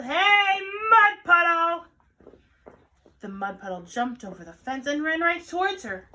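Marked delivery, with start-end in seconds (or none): 0.0-1.9 high, loud, singsong call; drawn-out shout; yelling. A narrator's reading. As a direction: holler it out across the backyard. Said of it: "and yelled".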